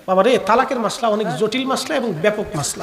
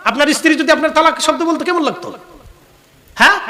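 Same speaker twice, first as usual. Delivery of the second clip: ordinary talk, nothing marked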